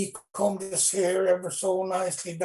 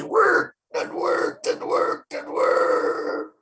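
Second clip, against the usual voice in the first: slow, breathy strained push; grunting against the big rock; straining effort; 4 dB louder, much slower than usual. Acted. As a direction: heave with no voice left.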